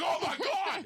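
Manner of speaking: shocked exclamation. Retold or imitated. Tom reacting to the sister's claim, high shocked cry. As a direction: blurt it in stunned disbelief. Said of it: "and I'm like".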